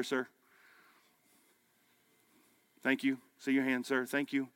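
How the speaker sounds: quiet, gentle invitation to pray; a pastor softly thanking men who raise hands; soft altar call. Acted. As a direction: draw them in softly, no pressure.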